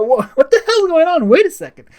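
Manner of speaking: very high, loud cartoon shriek of confusion; overplayed freak-out, screeching; shrieking mock panic. Imagined panic as they fly off.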